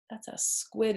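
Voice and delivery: usual speaking voice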